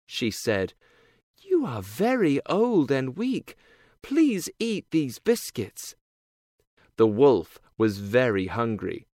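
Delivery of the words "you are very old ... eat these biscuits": put-on girl voice. A narrator's reading. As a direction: voice the girl, higher. Girl's voice.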